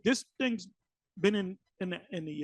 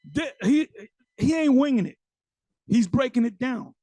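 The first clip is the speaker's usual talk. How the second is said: normal talking voice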